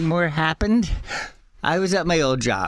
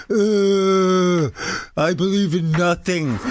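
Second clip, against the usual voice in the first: mock nihilist punk; 5 semitones higher, slower than usual. Mocking nihilist punk again.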